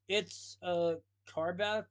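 slow, squeaky character voice; squeaked. A narrator's reading. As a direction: squeak it out slowly. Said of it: "Proudnose squeaked".

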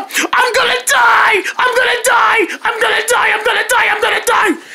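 high shouted mock terror, repeated; cartoonish high panicked wail; hammed-up panic. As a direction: wail it like doom is certain.

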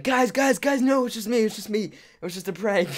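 high-pitched, pleading call; excited high voice, easing down toward the end; urgent reassurance. Retold or imitated. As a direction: call after fleeing friends, acted out. Calling out to fleeing friends.

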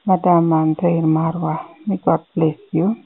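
ordinary talk, nothing marked

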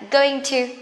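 "going to" in two full, careful words; unreduced "going to"; slow full form. Shown as a demonstration. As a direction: spell out "going to" unhurriedly. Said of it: "it's quite slowly".

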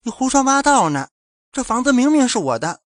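high, loud shocked protest; woman protesting in shock; stunned disbelief. A narrator's reading. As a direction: blurt it out in disbelief. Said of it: "一脸错愕地说".

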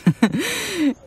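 helles, amüsiertes Auflachen; amüsiertes Lachen. Acted. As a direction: kurz und hoch auflachen. Amused at Robin's stubbornness. (lacht amüsiert über Robins Hartnäckigkeit)

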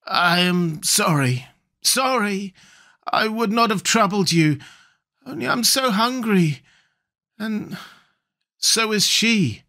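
high, plaintive, drawn-out pleading; hopeless and pathetic. A narrator's reading. Voice in the darkness apologising to the sailors for begging, hopeless. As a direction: apologise weakly and pitifully, lingering on the words. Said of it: "sounding extremely hopeless and pathetic".

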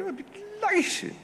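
put-on very high aristocratic old-society voice; Duchess of Devonshire. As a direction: play a delighted society lady. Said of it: "the Duchess of Devonshire".

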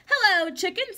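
cheerful duck greeting. Acted. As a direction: wave hello as a happy duck.

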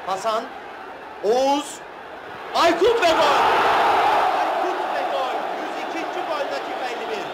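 loud, high goal shout; screaming football commentator. Acted. Goal call.

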